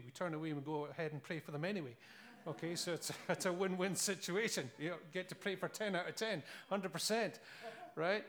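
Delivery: normal talking voice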